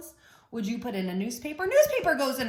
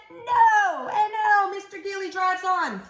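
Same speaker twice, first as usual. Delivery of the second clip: loud excited no; 6 semitones higher, 7 dB louder, slower than usual. Acted. Excited refrain.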